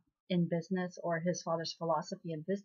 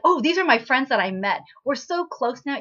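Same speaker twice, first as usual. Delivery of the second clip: higher, louder fake friendliness; mock breezy cover-up; 6 semitones higher, 7 dB louder than usual. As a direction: bluff cheerfully as if old friends. Kangchi covers up with false friendliness.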